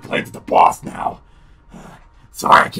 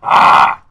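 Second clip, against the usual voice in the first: startled yelp; 13 dB louder than usual. Real, not acted. Sudden scare.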